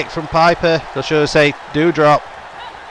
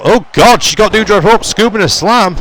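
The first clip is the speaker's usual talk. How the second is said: louder, higher, caught off guard; surprised excitement; 4 semitones higher, 3 dB louder than usual. Real, not acted. Commentator surprised by a slam, louder. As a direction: react to an unexpected lift.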